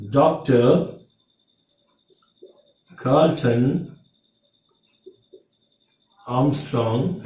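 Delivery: long pauses between words; name announced. Shown as a demonstration. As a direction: let each word of Doctor Carlton Armstrong land alone. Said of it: "announcing the name slowly".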